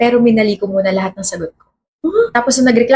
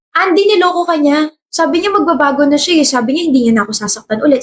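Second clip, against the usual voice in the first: usual speaking voice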